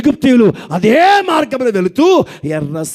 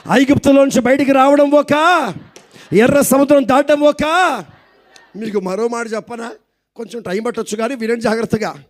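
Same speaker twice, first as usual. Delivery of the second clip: usual speaking voice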